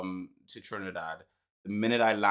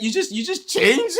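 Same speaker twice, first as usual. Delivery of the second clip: high, loud giggling accusation; laughing imitation; 8 dB louder than usual. Mate accusing him of changing, laughing high. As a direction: squeal it mid-laugh. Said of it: "He's like".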